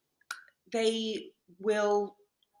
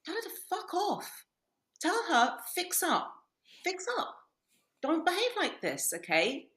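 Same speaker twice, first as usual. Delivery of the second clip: higher-pitched, insistent telling-off; exasperated retold advice; 6 semitones higher, 4 dB quieter than usual. Friend advising a husband about his wife, exasperated. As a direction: urge a friend to stand firm, reenacted.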